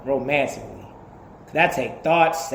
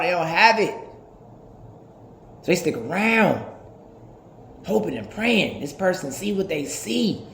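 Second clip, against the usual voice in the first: normal talking voice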